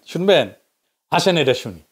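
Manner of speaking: ordinary talk, nothing marked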